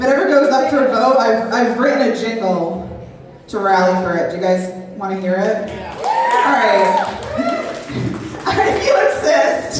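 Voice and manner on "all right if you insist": mock-eager shout, much higher; excited yell. Real, not acted. Comedian to the crowd, a high excited shout. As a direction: whoop it out like you can't wait to sing.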